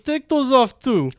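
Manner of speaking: imitated Russian command; Russian accent. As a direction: give orders as a Russian woman. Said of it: "a thick Russian accent".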